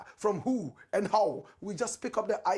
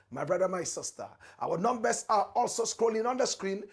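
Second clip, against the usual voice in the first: ordinary talk, nothing marked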